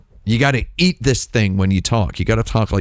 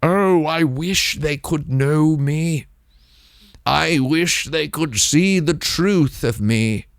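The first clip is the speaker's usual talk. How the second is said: high, slow airy dramatic sigh of a voice; dreamy Mimsy; 5 semitones higher, slower than usual. Retold or imitated. Mocking how Mimsy talks off stream, dreamy and slow. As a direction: swoon over every word like a tragic artist. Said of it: "That's what Mimsy s-, talks like".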